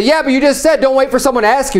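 quick, high-pitched "yes, but" retort; mock objection. Voicing an objecting listener.

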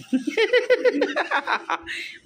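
high, breathy run of chuckles; real laugh. Real, not acted. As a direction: giggle helplessly at your own joke. Laughs after saying nothing else.

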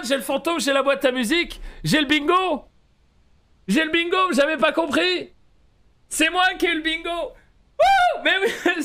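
high loud disbelieving joy; overjoyed high voice. Real, not acted. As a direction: squeal with sudden delight. Découvre qu'il a le bingo. (joie aiguë et incrédule)

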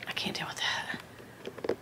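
whispered aside to herself; breathy whisper. Real, not acted. Muttering about crooked bag.

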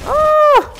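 loud, very high cry; falsetto scream. Real, not acted. Player after a bad move, falsetto scream.

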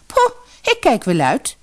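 high, huffy dismissal; scornful refusal. A narrator's reading. Spoiled daughter refusing her mother's errand, scornful. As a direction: scoff it off like a spoiled girl.

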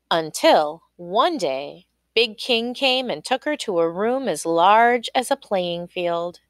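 usual speaking voice

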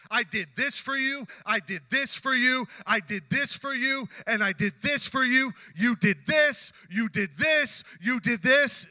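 loud, high list of grievances; angry tallying. Acted. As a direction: hammer out each accusation as a worked-up Jacob. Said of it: "starts just to go and tally everything".

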